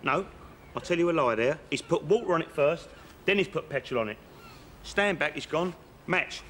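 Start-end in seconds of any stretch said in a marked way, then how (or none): none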